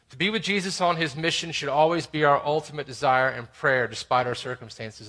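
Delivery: ordinary delivery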